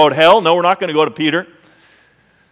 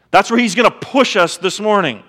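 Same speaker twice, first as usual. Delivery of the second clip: ordinary talk, nothing marked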